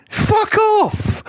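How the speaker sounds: very high squeaked fuck off; high mock dismissal; squeaky dismissal. Real, not acted. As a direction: squeak it in disbelief. Mocking the hard sell.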